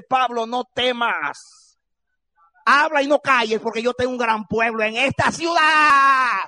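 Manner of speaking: loud, rising shout of God's promise; preaching at full force. Acted. Preacher to congregation, reading God's promise, shouting. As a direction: proclaim it to the whole church, voice climbing.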